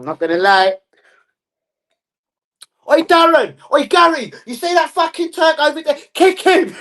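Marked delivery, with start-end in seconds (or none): 2.9-6.8 high, loud bellowed orders; mock touchline yelling. Parent ordering kids to foul an opponent, mock yelling. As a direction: holler across the pitch, hammed-up.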